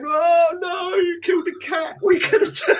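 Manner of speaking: put-on high, loud, shouting kids' voices; panicked kids. Kids in the car yelling at dad after the cat, shrill shout. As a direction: squeal it like horrified children in the back seat. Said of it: "kids in the car going".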